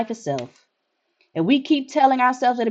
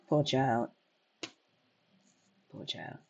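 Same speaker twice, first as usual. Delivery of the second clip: low, much softer murmur of pity; hushed pity; 9 semitones lower, 12 dB quieter, slower than usual. Real, not acted. Player pitying the orphaned child, hushed. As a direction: breathe it out with sorrow.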